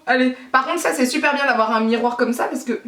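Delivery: normal talking voice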